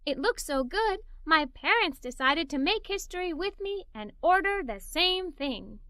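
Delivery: usual speaking voice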